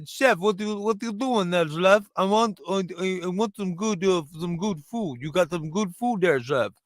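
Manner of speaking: Borat-style Russian accent. Shown as a demonstration. Comedian demonstrates how his Russian buddy talked, Russian accent. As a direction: do a thick-mouthed Russian accent, a little Borat. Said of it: "the only way I can do a Russian accent".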